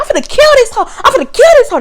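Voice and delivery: high, loud imitated threat; mock threat. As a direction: ham up the coworker's fury. Coworker's repeated threat, retold.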